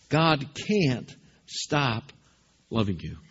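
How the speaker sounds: an unhurried, tender reassurance; slow tender refrain. Real, not acted. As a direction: let each word land gently. Closing refrain, slow and soft.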